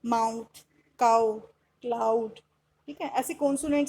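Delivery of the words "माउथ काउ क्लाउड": माउथ, काउ, क्लाउड each alone with open ow; OW sound. Shown as a demonstration. Teacher giving examples of o-u, clear ow in माउथ, काउ, क्लाउड. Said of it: "आउ की sound बन रही है".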